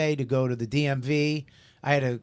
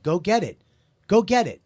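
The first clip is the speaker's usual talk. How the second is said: raised, emphatic command; retold insistent order; 5 semitones higher, 5 dB louder than usual. Boss sending staff after the ID, insistent order. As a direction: push the order higher and harder each time.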